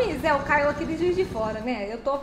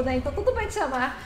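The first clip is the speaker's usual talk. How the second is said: ordinary delivery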